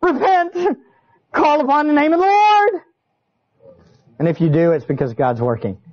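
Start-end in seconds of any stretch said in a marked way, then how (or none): 0.0-2.8 preaching call. Acted. Preacher calls out to listeners.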